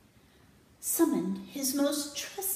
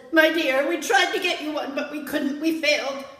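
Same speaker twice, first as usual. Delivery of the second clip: loud apologetic king; 5 semitones higher, 10 dB louder than usual. Acted. King reports to princess.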